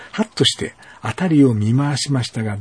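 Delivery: usual speaking voice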